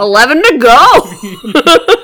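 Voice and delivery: slow, loud, laughing. Real, not acted. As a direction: laugh through the words. Laughing about spending goal.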